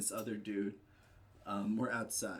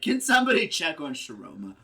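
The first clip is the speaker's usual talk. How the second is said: loud, raised call to the neighbours; mock scream out; 6 semitones higher, 11 dB louder than usual. Sleepless neighbour wanting to call into the alley, mock screaming. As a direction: shout it out as if from bed. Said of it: "I really wanted to scream out".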